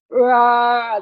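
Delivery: loud high mock roar of aggression; a loud mock roar imitating the husband; aggressive roar. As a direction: bellow it like an attack. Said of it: "That's how it would sound in my head".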